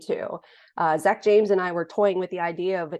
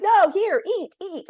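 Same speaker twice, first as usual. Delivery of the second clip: high, loud coaxing host voice; insistent host; 12 semitones higher, 5 dB louder than usual. Retold or imitated. Iraqi hosts urging the American guest to eat, high and pushy. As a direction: push food on the guest, pitched way up. Iraqis feeding him by hand.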